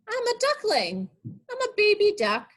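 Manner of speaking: high little duckling voice; baby duck voice. Pretend. Teacher voices the duckling for young listeners.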